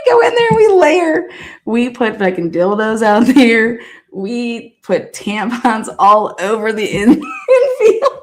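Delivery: cracking up while telling the prank; laughing. Real, not acted. Friend cracking up recalling the tampon prank on the field, laughing.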